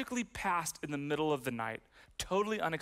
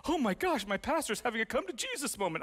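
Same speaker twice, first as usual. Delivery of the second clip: mock gasp of onlookers; 6 semitones higher than usual. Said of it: "Everyone is like".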